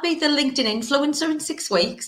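high, breezy overconfident voice; naive past self. Retold or imitated. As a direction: play your cocky younger self. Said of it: "[name] two years ago, thinking".

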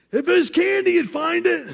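high, loud mocking retort; boss's jab. Mean boss mocking the worker, high loud jab. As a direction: snap it back as a put-down. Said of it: "he had this line".

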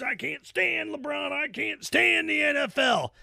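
slow mock of a griping fan, ending in a yell; mocking whiny fan. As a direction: parody the fan who hates the pros, drawn out. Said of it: "all these pros that you can't stand".

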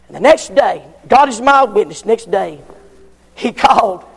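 ordinary talk

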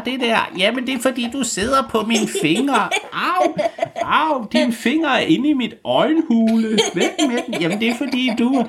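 high, whiny skeleton voices bickering; squeaky skeleton squabble. Acted. Skeletons tangled in a heap complaining to each other, squeaky whining.

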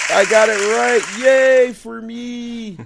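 high, loud cry of triumph, drawn out at the end; gleeful self-cheer. Real, not acted. Celebrates getting a hard name right.

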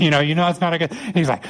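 ordinary talk

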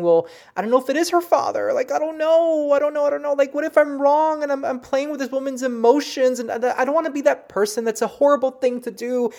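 high-pitched, anxious spiral of doubt; retold panic, pitch climbing and staying up; freaking out. Reader doubting herself before sharing a message, freaking out. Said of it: "I'm really freaking out".

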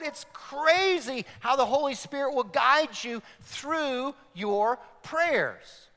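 ordinary delivery

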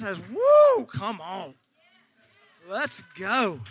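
ordinary delivery

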